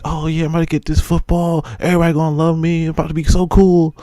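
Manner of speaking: imitating his hopeful child self; young self excited. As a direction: play the cocky little kid about to be cool.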